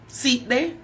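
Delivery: ordinary talk, nothing marked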